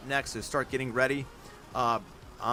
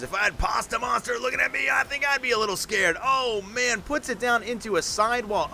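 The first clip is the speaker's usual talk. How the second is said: live play-by-play; 4 semitones higher than usual. Real, not acted. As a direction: hype up each jump for the crowd. Live monster truck play-by-play.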